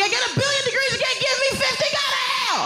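high furious yell at the sky; yelling at the sun. Retold or imitated. Standing in the cold, yelling up at the sun, furious. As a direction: scream up at the sun, outraged. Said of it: "yelling at the sun".

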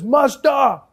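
loud, high-pitched old grandfather impression; hammed-up, drawn-out plea to the master; grandfather addressing policeman. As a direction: slowly call out in a put-on elder's voice. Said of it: "my grandfather turns to the cop".

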